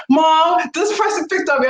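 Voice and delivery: high, lightly voiced child complaining; playing her daughter telling on a kid; whiny daughter. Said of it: "even my daughter be like".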